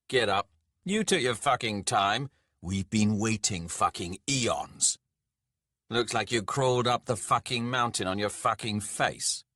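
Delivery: loud, cursing, impatient god; gruffly scolding dwarf overlord; irritated dwarf lord. Acted. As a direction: berate a lowly mortal who kept you waiting. Impatient dwarf lord berates Skalg.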